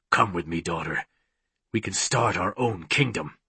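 low, soft villain voice; coaxing villain. A narrator's reading. Villain inviting his daughter to join him, coaxing. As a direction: coax her quietly, low.

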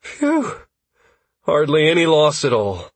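high, breathed-out relief; relieved. A narrator's reading. Constantine relieved that hardly anything was lost, high and relieved. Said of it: "breathed a deep sigh of relief".

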